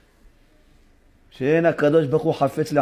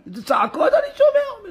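God complaining. Acted. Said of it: "אומר השם".

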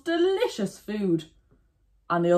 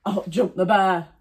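ordinary delivery, nothing marked

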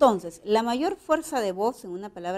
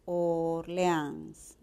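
"Orleans" slow and softer, OR-LE-ANS; syllable by syllable; 3 semitones lower, 5 dB quieter than usual. Shown as a demonstration.

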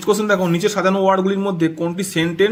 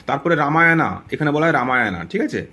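ordinary delivery

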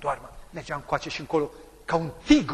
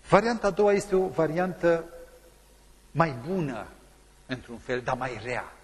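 ordinary delivery

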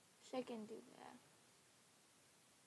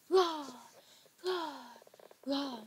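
loud, higher playful roars; pretend roar; 4 semitones higher, 8 dB louder, slower than usual. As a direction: belt out short mock roars. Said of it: "I just roared the whole entire".

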